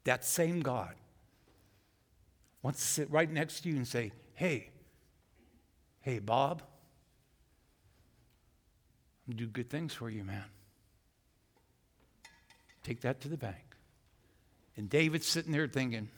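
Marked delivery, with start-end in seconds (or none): 4.3-13.8 friendly God voice. Acted.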